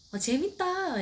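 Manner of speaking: high, loud delighted exclamation; gleeful delight. Retold or imitated. Mom pulling out her hair, high gleeful exclamation. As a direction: squeal with glee at the fun. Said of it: "머리를 뽑는 게 너무 재밌는 거예요".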